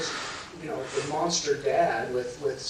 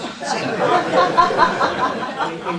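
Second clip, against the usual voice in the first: pained laugh; 13 semitones higher, 10 dB louder than usual. Real, not acted. Laughing at son's leap onto lap.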